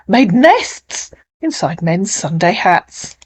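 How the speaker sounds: animated, lilting storytelling verse reading; dramatic poetry reading; poem recitation. Acted. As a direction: recite the tale with lively rises on key words.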